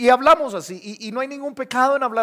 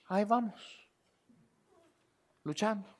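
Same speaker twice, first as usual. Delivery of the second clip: quieter, worn-out imitation of a weary believer; mock tired sigh; 11 dB quieter than usual. Preacher imitating a weary believer answering how are you, quietly worn out. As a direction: drag it out like someone half-defeated. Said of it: "Y dices".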